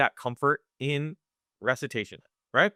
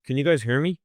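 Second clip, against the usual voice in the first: mumbling. Shown as a demonstration. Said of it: "I'm kinda mumbling".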